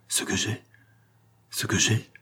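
put-on hushed, faltering old servant; half-whispered, stunned reply; stammering. A narrator's reading. Old Antoine startled at the sight of his master's leg, stammering. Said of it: "balbutia le vieillard".

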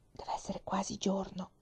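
soft unvoiced whisper. Laura whispering to her sister in the dark bed. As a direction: whisper it in the dark, barely a breath. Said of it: "Laura sussurrò".

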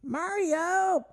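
very high cartoon call; squeaky falsetto calling out; Toad. Co-host calling his partner into the scene, high cartoon voice. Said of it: "That almost sounds like a Toad".

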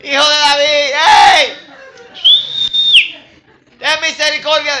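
high, loud, stretched-out calls; shouting at the top of lungs. Acted. Blind man begs Jesus for mercy, shouting. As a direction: holler to be heard down the road. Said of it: "Gritando a voz en cuello".

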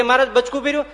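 loud, high, excited ask; eager and playful; eager question. Retold or imitated. Brahmanand asks eagerly. (ઉત્સાહથી પૂછે)

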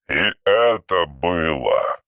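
low, very slow archaic voice; an old machine speaking in verse; ancient robot. Acted. (низкий медленный голос древнего робота)

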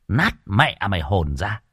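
usual speaking voice

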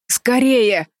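high, urgent begging; desperate plea. A narrator's reading. Колтон hangs by one hand and begs, desperate plea. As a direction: beg them to hurry. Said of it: "взмолился Колтон".

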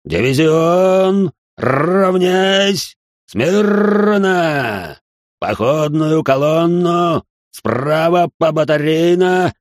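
slow, loud, high drawn-out commands; shouting drill sergeant. A narrator's reading. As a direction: stretch each command across the parade ground. Officer commands march formation.

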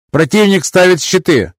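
high crisp operator voice; combat callout. Acted. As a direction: ship operator reporting. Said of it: "доложил оператор Грэб".

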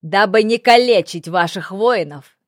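put-on loud formal proclamation from a young envoy; ringing, projected youthful voice; young, ringing herald. A narrator's reading. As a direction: call the surrender terms up to the battlements. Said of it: "на удивление молодой и звонкий".

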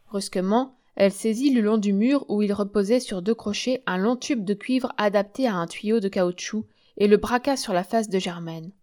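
ordinary delivery, nothing marked

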